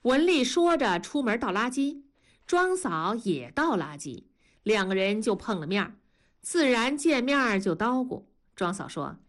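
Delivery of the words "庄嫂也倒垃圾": usual speaking voice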